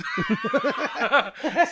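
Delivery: high cackling laugh; cracking up at the headline; burst of laughter. Real, not acted. Host cracks up at title.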